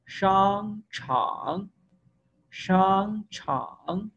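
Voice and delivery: slow, careful Mandarin syllables for the class; drawn-out Chinese drill, each tone shaped; Chinese pronunciation demo. Shown as a demonstration. Teacher reading Mandarin aloud to students, slow and careful. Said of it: "teacher demonstrates Chinese words".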